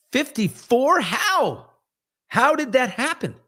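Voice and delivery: high-pitched incredulous outburst; comic shocked squeal; mock disbelief. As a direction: voice shoots way up, aghast at the number. Mock shock at his own age.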